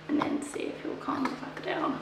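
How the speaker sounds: quiet whispered aside. Real, not acted. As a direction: whisper it so the pup can't hear. Aside about hyper puppy.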